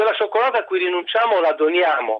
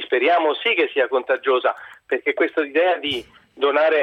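ordinary talk, nothing marked

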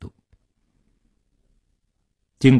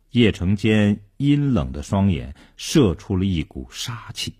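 plain reading voice

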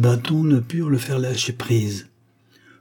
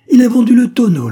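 much higher and louder accusation; the old woman shrieks her accusation, much higher and louder; furious shriek; 13 semitones higher, 12 dB louder than usual. A narrator's reading. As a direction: hurl the charge at the boy.

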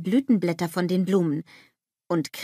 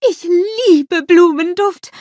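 high, loud, drawn-out rapture; schwärmerisch gushing; 11 semitones higher, 10 dB louder than usual. A narrator's reading. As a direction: gush it slowly, pitched right up. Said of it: "schwärmt Olaf".

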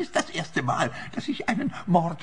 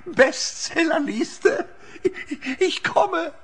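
high giddy cackle of triumph; giddy laughing; 11 semitones higher, much slower than usual. Acted. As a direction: laugh at dreams of fame. Dreams of bestseller.

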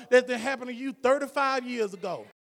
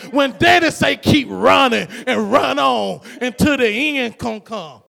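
loud, slowed, Spirit-filled hollering; ecstatic preaching shout; 7 dB louder than usual. Real, not acted. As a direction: let the Spirit carry each cry out.

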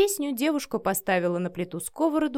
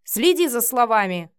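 loud, indignant command; heated reprimand; angry warning; 3 semitones higher, 7 dB louder than usual. A narrator's reading. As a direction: tell them off sharply. Angry husband warns his wife.

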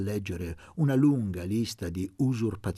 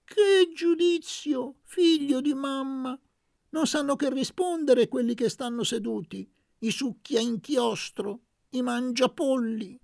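put-on high, loud old woman's voice, scornful of the rich; an old village woman jeering the gentry; old woman praises Luca and mocks the landowners, high and loud; 6 dB louder than usual. A narrator's reading. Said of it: "Una vecchia disse".

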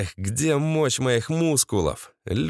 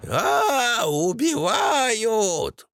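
long high-pitched witch's howl; siren-like wail; 9 semitones higher, much slower than usual. A narrator's reading. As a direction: wail like a fire siren, drawn out. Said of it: "Пожарной сиреной взвыла ведьма". (вой ведьмы пожарной сиреной)

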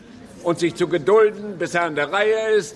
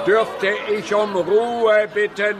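demanding silence; 6 semitones higher, slower than usual. Acted. Chairman demanding silence from the quarrelling men. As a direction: stretch it out over the noise.

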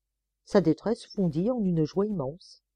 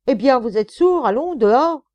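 higher, loud furious bark; angry shout; 7 semitones higher, 10 dB louder than usual. Acted. Sergeant yelling at the dazed prisoner, furious shout. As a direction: roar at him to get out.

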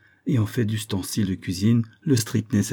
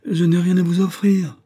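high, louder whining plea; pitiful voice; 10 semitones higher, 7 dB louder than usual. A narrator's reading. Miser whining to unwanted visitors, high and pitiful. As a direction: plead poverty pitifully. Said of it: "d'une voix piteuse".

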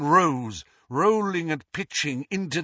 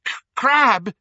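choking out the name, higher and louder; choked cry; 10 semitones higher, 7 dB louder than usual. A narrator's reading. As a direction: gasp the name with a broken catch. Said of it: "choked Malfoy".

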